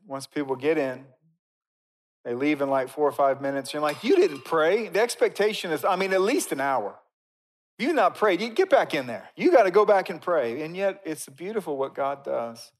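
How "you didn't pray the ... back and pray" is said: loud, higher indignant reproach; mock scolding. Preacher acts out rule-enforcer scolding short prayers, mock indignant. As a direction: scold them and send them back.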